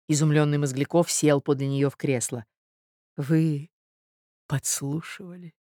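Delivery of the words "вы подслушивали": halting question, falling very soft; reproachful. A narrator's reading. Marya asks if he eavesdropped, reproachful. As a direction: let the accusation sink with quiet reproach. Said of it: "укоризненно глядя ему в лицо".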